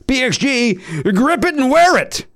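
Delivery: high, slow slogan read; announcer tagline. Acted. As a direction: sell the tagline big and slow.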